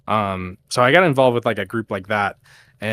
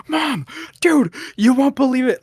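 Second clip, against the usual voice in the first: much higher and louder, bursting with news; retold excited call; 13 semitones higher, 6 dB louder than usual. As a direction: blurt it out to mom, thrilled. Calling mom excitedly.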